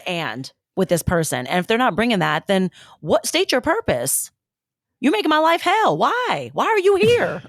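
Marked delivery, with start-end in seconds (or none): 3.2-7.4 sassy confrontation. Retold or imitated. Confronting bad partner, acted.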